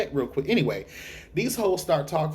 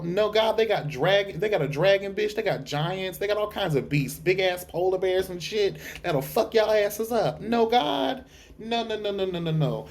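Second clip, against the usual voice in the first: much higher, campy no-no-no; hammed-up fright at the army of the dead; high, playful alarm; mock panic; 6 semitones higher than usual. The speaker panics about the army.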